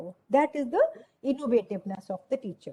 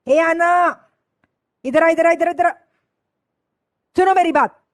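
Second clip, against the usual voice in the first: put-on loud, sharp teacher calling a child over; harsh shout; 5 semitones higher, 9 dB louder than usual. Teacher harshly shouting at a child to come here. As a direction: bark the order across the room. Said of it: "कड़क होके बोले".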